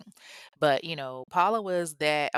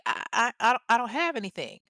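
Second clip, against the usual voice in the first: confused stammer; 7 semitones higher, faster than usual. Retold or imitated. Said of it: "Donovan is confused".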